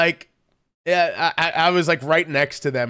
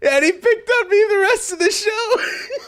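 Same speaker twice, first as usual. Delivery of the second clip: very high, squeaky words through real laughter; helpless laughing; 9 semitones higher than usual. Real, not acted. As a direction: keep telling it while cracking up. Cracking up while telling the story.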